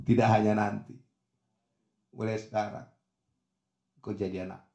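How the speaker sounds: low, soft words with pauses; tender. Acted. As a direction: grant it gently, letting the voice sink. Jesus tenderly adopts the thief. (lembut, rendah, penuh kasih)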